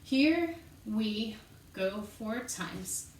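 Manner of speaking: measured, spaced-out words in time; slow count-in. Shown as a demonstration. Teacher leads students into four repeats, slow count-in. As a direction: count them in slowly, word by word.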